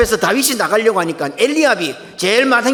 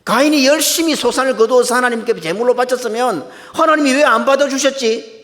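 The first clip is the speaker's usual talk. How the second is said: raised, higher voice of aggrieved complaint; indignant protest; 6 semitones higher, 3 dB louder than usual. Acted. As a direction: protest as if wronged, stretching the final question. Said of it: "가인은 항변하고 있습니다".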